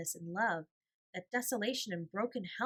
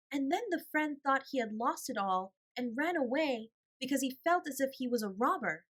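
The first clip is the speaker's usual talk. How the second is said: put-on higher little girl voice, eager; child asking; 7 semitones higher, 4 dB louder than usual. A narrator's reading. Little Nora eagerly asking the gentleman about the lost friend. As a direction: ask like a curious child.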